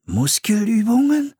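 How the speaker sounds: high, alarmed question; startled thought, pitched right up. A narrator's reading. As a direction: blurt it in sudden dismay. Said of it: "dachte Philipp erschrocken".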